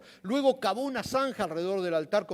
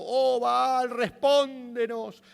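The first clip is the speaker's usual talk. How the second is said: high, loud, drawn-out cry to a god; chanting shout of desperate worshippers; shouted plea; 9 semitones higher, 5 dB louder, much slower than usual. A narrator's reading. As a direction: call out "oh" long and high, begging an answer.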